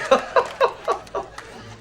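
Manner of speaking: breathless high laugh; laughing helplessly; high squealing laugh. Real, not acted. Host cracking up at the scene, squealing laugh.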